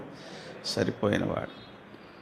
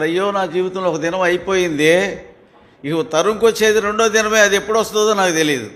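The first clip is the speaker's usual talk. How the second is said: higher, louder, faster anguished wail; preacher playing the crying newborn, loud and high; newborn's lament; 6 semitones higher, 4 dB louder than usual. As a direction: voice the baby crying out in dread. Voices the newborn's fearful lament.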